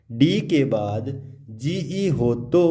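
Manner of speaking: ordinary talk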